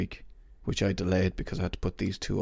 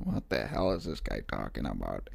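low, soft, baffled mutter of a puzzled listener; confused future listener; 10 semitones lower, 7 dB quieter than usual. Retold or imitated. Host imagining a listener hearing this next year, low and baffled. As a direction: drop low and quiet as a bewildered outsider. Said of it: "you're, like, going".

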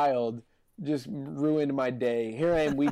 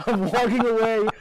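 ordinary delivery

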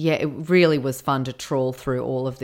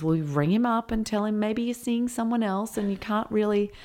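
higher, mock-earnest advice voice; convoluted advice-giver; 6 semitones higher than usual. Quoting the advice she gives, in a mock-earnest voice. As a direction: voice her tangled advice, lightly put on. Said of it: "she gives this really convoluted kind of reasons".

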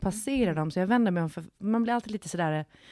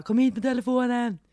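high, raised-voice retold shout; calling out; 6 semitones higher, 6 dB louder than usual. Woman shouting to boyfriend from the bathroom, retold call. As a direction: call urgently to another room. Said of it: "jag ropade på min kille".

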